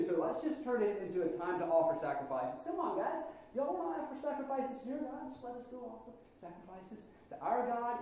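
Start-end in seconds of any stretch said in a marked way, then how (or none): 2.6-8.0 higher, eager coaxing plea; coaxing Israelites. Pretend. Israelites coaxing Pharaoh for a festival, higher and eager. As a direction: sweet-talk the king into letting them go.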